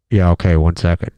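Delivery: low, flat-toned "Yeah, okay, one second." after a long pause; deep deadpan reply. Pretend. Homeowner answers the intercom after a pause, low. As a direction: sink the voice way down and hold it. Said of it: "There's a dramatic pause, and then".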